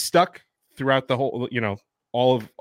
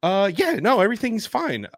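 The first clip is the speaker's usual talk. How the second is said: pitched way up, hurried reassurance; high nervous reassurance; 10 semitones higher than usual. Retold or imitated. Reassuring a worried coworker, in a high nervous voice. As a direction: squeak it out like nothing's wrong. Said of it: "I was like".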